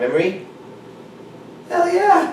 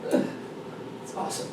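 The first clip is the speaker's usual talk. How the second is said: soft whispered pat on the back; whispered self-praise; 15 dB quieter than usual. Shown as a demonstration. Coach whispers praise to himself in his head, hushed. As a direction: whisper it like your own biggest fan. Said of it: "let me speak as my biggest fan".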